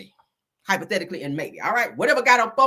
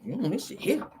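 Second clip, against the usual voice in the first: usual speaking voice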